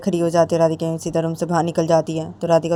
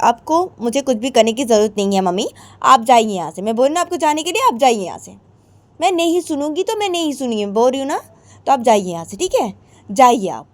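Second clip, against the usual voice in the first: dismissive daughter; 6 semitones higher, 4 dB louder than usual. Acted. Radhika dismissing mother.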